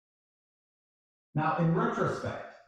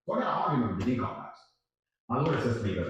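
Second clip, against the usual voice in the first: usual speaking voice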